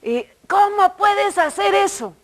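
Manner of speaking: high-pitched imitated reproach; mock indignant scolding. Church server scolding a confused visitor, mock indignation. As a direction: demand an answer, indignantly, in imitation. Acting the older woman's scolding.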